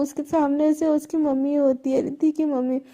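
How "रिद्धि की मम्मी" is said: plain reading voice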